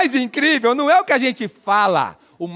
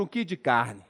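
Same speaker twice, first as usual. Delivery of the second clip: "um quilo de carne" squeezed to "um ki di carn" in Mineiro; Mineiro contraction; 10 semitones lower, 8 dB quieter than usual. Shown as a demonstration. Comedian showing Minas speech, "um quilo de carne" contracted Mineiro-style. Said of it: "Diminuir a quantidade de sílabas".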